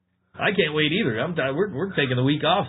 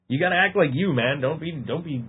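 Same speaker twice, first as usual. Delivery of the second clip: ordinary delivery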